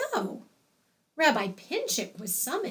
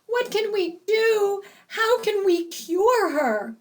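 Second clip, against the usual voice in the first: anxious pleading; 9 semitones higher, 3 dB louder than usual. Acted. Fainted mother pleading.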